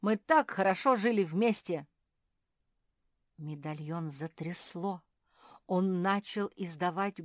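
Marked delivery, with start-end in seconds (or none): 0.0-1.9 loud, insistent appeal; pleading demand. A narrator's reading. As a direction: press your claim loudly. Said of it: "потребовал Филипп".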